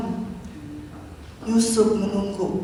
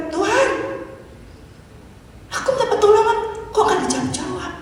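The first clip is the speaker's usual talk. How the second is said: mock whiny prayer; 11 semitones higher, faster than usual. Believer whining to God about unanswered prayer, mock laughing. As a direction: play the impatient believer, half laughing. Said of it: "Sering kan juga kita bilang".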